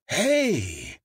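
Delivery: usual speaking voice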